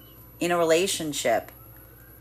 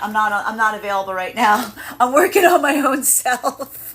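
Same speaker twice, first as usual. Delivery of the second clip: laughing; 4 semitones higher, 4 dB louder than usual. Pretend. Laughing through a mock reply.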